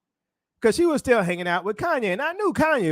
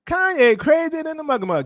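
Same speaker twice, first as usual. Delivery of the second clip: higher and louder, driving it home; emphatic repeat; 6 semitones higher, 4 dB louder than usual. Shown as a demonstration. Telling viewers about [name], insistent and loud.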